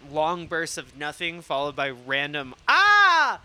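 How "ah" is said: high, loud "ah!" burst; sudden pretend outburst, much higher and louder; sudden mock scream. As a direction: blurt a loud, high yelp as illustration. Imitates random burst amid life's nothing.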